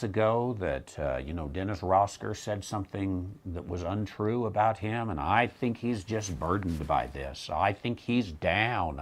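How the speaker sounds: soft, lightly acted concern for a troubled friend; concerned churchgoer. Church member confiding worry about the pastor, lightly imitated hushed concern.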